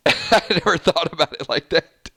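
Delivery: words broken up by real laughter; laughing. Real, not acted. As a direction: laugh your way through the line. Amused by a viewer's comment.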